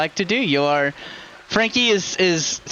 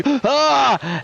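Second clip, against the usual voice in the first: sharp, high gasp; sudden gasp; 8 semitones higher than usual. Acted. Wounded man jolts awake, sudden gasp. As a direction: suck in air with a voiced gasp. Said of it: "takes a deep breath and goes".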